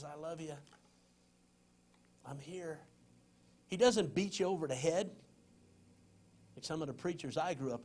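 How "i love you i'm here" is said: soft, quiet divine reassurance; God's gentle voice. Acted. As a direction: say it softly like a gentle call home. God's gentle call to the separated.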